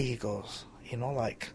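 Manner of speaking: usual speaking voice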